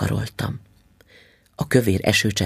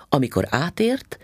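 ordinary delivery

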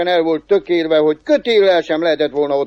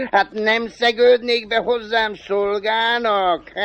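high, slow devil voice between cackles; chuckling devil; 6 semitones higher, slower than usual. Acted. Devil hiring the cobbler as servant, high and chuckling. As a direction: make the offer in a high, giggly devil voice.

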